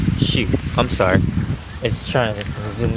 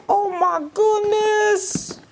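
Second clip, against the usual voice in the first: high, slow, gushing delight; delighted opening a present; slower than usual. Real, not acted. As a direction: squeal it out with joy. Parent gushing over present.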